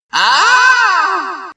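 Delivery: sudden piercing high shriek of pain; high scream. A narrator's reading. Della screams in pain as powers take hold. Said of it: "screamed Della".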